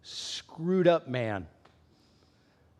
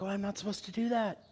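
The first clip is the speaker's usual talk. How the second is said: guilty aside; 10 dB quieter, faster than usual. Retold or imitated. Mom regretting yelling.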